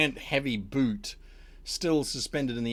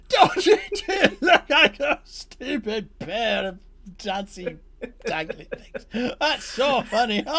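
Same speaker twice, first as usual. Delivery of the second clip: high cackling taunt through laughter; laughing mockery; 9 semitones higher, 5 dB louder than usual. Redcap mocking his dancing brothers, laughing loudly. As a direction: jeer while laughing hard. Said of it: "He is laughing incredibly loudly".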